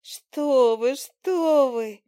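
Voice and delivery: embarrassed fly. Acted. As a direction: protest bashfully. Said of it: "Мушка смущённо отвела взгляд".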